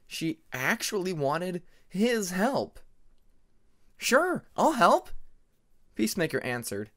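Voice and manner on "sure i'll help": high, slow, eager burst of a yes; overly enthusiastic. Acted. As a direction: jump at the offer a bit too keenly. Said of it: "maybe a little too enthusiastically".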